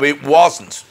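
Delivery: ordinary delivery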